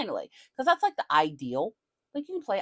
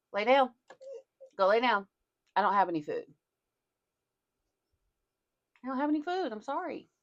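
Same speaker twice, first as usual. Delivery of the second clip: higher firm coaxing to the dog; pet voice; 7 semitones higher, faster than usual. Real, not acted. Owner refusing the dog food, higher pet voice. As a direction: tell the dog off sweetly.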